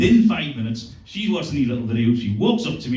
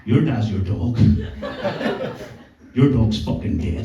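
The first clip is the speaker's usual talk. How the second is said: drunk dad impression; 3 semitones lower than usual. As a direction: imitate a drunk dad, pitched down and forceful. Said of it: "did an impression of her drunk dad, and in a very broad".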